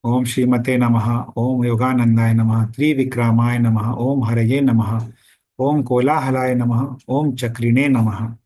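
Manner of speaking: rapid spoken-style recital, little drawing out; quick mantra recitation. Shown as a demonstration. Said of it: "वैसा ही उच्चारण किया गया है".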